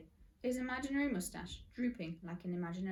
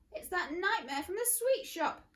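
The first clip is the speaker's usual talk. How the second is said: high, loud alarmed cry; alarmed exclamation; 10 semitones higher, 7 dB louder than usual. Acted. Mister Gum crying out at the boy, high and loud. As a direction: shout it in shock. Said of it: "Mister Gum exclaimed".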